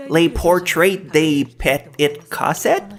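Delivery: slow, over-Anglicized French title in an American accent; masked French accent. Shown as a demonstration. Host retries the title to hide French, exaggerated American accent. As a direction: spell it out slowly with mock American pronunciation, rising at the end. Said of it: "try to mask my French accent".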